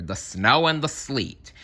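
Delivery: ordinary delivery, nothing marked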